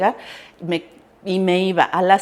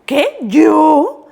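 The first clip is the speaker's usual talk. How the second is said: high, loud disbelieving squeal; can't believe it's me; retelling her own shock, pitched way up; 8 semitones higher, 8 dB louder than usual. Retold or imitated. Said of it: "así yo con".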